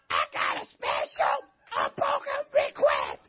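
very high, slow, strained mock voice; mock squeaky request. Shown as a demonstration. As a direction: squeak the request like a timid churchgoer. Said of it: "little teaching lesson".

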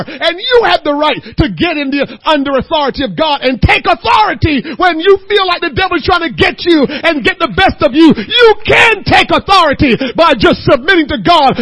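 loud, rising preacher's cadence; preaching. Acted. Preacher urging his congregation, loud and rising. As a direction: preach it with growing force.

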